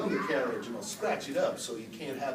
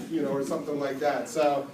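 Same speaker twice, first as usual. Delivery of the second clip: usual speaking voice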